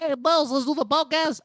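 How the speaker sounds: put-on Filipino accent. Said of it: "the accent's so fu".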